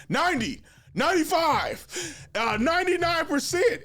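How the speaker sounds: high, loud eager guessing; mock the overconfident guys; confident dudes. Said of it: "they'd be like".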